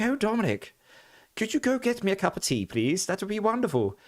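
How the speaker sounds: put-on very high, plummy posh English accent; posh nan. Retelling his nan asking him for tea: posh accent. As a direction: impersonate a posh grandmother asking sweetly. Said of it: "she legitimately sounded like, um, Elizabeth the second".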